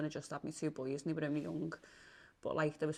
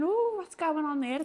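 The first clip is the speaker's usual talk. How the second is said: high, slow, louder wondering; drawn-out re-enacted puzzlement; retold wary surprise; 10 semitones higher, 7 dB louder than usual. Voices her own suspicious thought.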